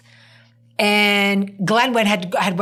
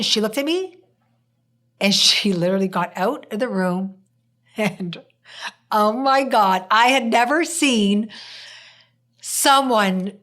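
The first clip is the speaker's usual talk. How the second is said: ordinary talk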